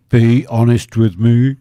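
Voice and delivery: host pressing Koki to admit the bet failed, mock pleading. Shown as a demonstration. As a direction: lean in and demand the truth, half-joking. Repeats plea to Koki.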